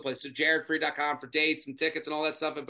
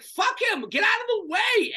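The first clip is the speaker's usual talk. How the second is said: high-pitched exasperated yell; acted fed-up shout, pitched high; exasperated outburst. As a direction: yell it like you're fed up waiting. Acted impatient outburst at a man.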